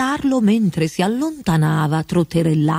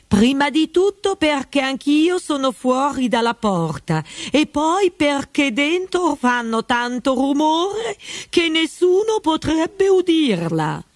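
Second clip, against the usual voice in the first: higher, odd voice, slowing at the end; frog footman voice; 8 semitones higher than usual. A narrator's reading. Frog footman tells Alice nobody can hear her, in a higher voice. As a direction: play the frog footman, matter-of-fact.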